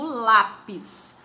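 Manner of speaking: ordinary talk, nothing marked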